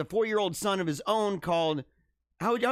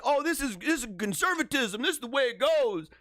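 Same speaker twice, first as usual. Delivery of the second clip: higher, hammed-up mock quote; mocking imitation; 6 semitones higher than usual. Host mocking the group's excuses, hammed-up imitation. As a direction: parody their self-justifying talk, pitched up.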